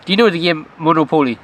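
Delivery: MONOPOLY mimicked as "mono-POH-lee"; mock the accent, Monopoly stressed on "po"; Monopoly with every o full, imitating a learner; imitated mispronunciation. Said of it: "you didn't say it like".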